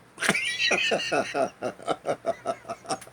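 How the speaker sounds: wheezing unvoiced laugh; breathy laugh. Real, not acted. Teller wheezing with laughter after the punchline.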